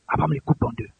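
low, soft narration; hushed telling. Real, not acted. Preacher narrating Abraham's obedience, low and hushed. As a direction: drop right down to tell it. Narrates the act after command.

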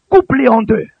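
loud, forceful pulpit command. Retold or imitated. God ordering Abraham to cut animals, loud command.

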